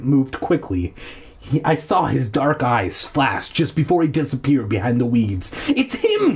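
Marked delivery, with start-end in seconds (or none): 5.7-6.3 high, panicked cry of recognition; frightened cry. Acted. Cassie crying out at the hermit, high and frightened. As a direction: point and cry out in fear. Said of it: "Cassie cried".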